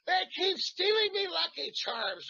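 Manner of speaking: high, slow, shouted Irish leprechaun voice; Irish accent. Shown as a demonstration. Actor pitching an Irish accent for the priest, shouted leprechaun Irish. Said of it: "Irish accent".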